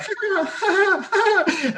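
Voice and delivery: high, nervous giggle; a panicky high laugh while putting out the fire. Retold or imitated. Masseur beating out the flames, laughing high and nervously. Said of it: "nervous laugh".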